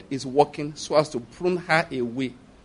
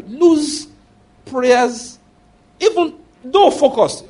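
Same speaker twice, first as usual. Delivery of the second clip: high, loud preaching burst; fiery preaching; 6 semitones higher, 5 dB louder, much slower than usual. Real, not acted. Preacher pressing the church at full force. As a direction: drive it home from the pulpit.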